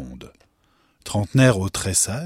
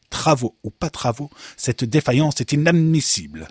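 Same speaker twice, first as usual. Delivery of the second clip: higher, raised voice of indignation; indignant; 6 semitones higher, 5 dB louder than usual. A narrator's reading. Director reprimanding his head guard, indignant. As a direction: protest firmly at a security failure. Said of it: "s'indigna le directeur".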